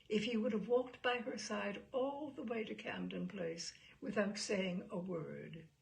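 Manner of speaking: usual speaking voice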